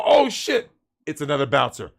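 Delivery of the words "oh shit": high, startled retold reaction; high-pitched mock alarm; shocked. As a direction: replay the jolt of seeing the blood. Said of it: "I go".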